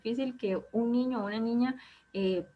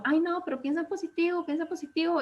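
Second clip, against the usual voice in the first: mock cheerful advice; 7 semitones higher than usual. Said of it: "hay personas que nos dicen como".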